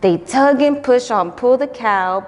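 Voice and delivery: plain reading voice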